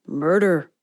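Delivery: quipped title. A narrator's reading. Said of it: "quipped as if it were the title".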